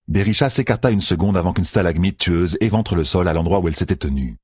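plain reading voice

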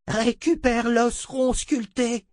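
urgent sorceress command. Acted. Adi orders Zed.

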